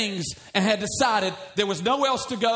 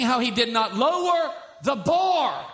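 preaching at full force; 5 semitones higher than usual. Real, not acted. Preaching emphasis.

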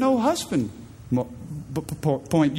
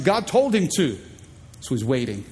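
usual speaking voice

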